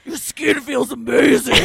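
loud growling romance line; growly wrestler voice. Pretend. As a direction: growl like a brute in a romance novel. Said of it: "get Braun Strowman to do these".

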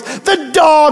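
mock exasperation; stressed commercial lady. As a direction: wail it like a frazzled woman in an ad. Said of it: "She was so stressed".

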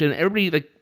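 ordinary talk

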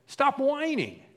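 high, loud, exasperated rebuke; acted sharp telling-off; scolding. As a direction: scold them out of their complaining. Acts Jesus scolding the apostles.

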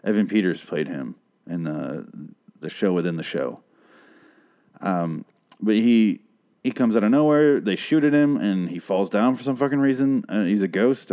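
usual speaking voice

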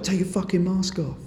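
a hint of a bitter old man barking; imitating an old man snapping; grumpy old man. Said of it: "he was like".

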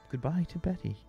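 normal talking voice

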